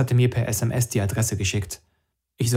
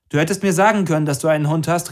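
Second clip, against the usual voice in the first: higher, raised voice in reproach; calling out; 6 semitones higher, 5 dB louder, faster than usual. A narrator's reading. Narrator reproaches Max through the closed door, calling out. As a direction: call your complaint through a door. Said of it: "rief ich durch die Tür".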